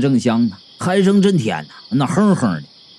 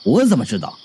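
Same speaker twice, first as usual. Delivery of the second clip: lower-pitched impatient reply; impatient retort; 6 semitones lower, faster than usual. A narrator's reading. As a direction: brush the question off, voice dropped. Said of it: "方涛不耐烦地说".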